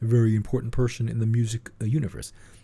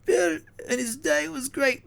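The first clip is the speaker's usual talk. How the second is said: much higher, slow, wavering [name] voice; [name] impression; slower than usual. Doing [name] for his co-host, high and slow. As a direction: drag it out high and wobbly like [name]. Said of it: "[name] said".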